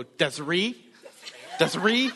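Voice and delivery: mock-stern little boy, questioning lift; kid scolding mom. Son calls out his mom by name, mock scolding. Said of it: "He'll go".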